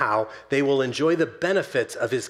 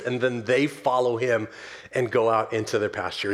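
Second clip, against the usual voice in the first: ordinary delivery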